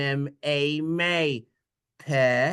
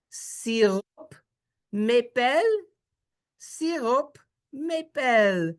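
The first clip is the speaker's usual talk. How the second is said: Israeli Hebrew accent; 9 semitones higher, much slower than usual. Shown as a demonstration. Teacher shows how Israelis say sirop maple, Israeli accent. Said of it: "as they say in Israel".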